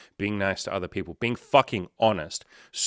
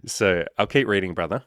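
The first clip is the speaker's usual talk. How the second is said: ordinary talk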